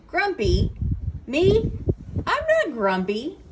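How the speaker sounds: loud, rising disbelief, then denial; indignant. A narrator's reading. Jim protests to Norman and Snake, indignant. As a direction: protest the charge, pitch leaping up on "me".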